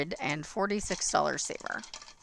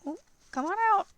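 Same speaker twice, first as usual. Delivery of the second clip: coaxing; 13 semitones higher, 4 dB louder, much faster than usual. Acted. Coaxing items from envelope.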